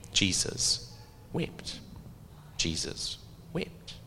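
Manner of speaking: soft, sparse words with long pauses; hushed solemnity. Real, not acted. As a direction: drop to a grave hush and let it sink in. Solemn contrast to imagined celebration.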